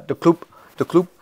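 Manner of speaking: badly faked Spanish accent; light, throwaway attempt at a Spanish accent; mock Spanish-accented catchphrase; Rafa Benitez. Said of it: "Rafa Benitez accent".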